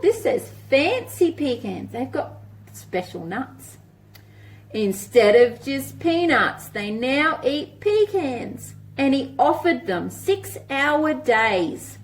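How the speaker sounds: lilting picture-book read-aloud; reading to children. Acted. Teacher reading a rhyming book to children, lilting. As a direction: read it like a storybook for little ones.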